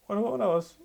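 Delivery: ordinary delivery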